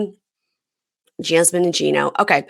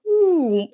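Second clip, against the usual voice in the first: high "ooh" like a stand giving way; mock sound effect of drooping; imitated deflating sound; 13 semitones higher than usual. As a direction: make a high droop noise. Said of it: "they would go".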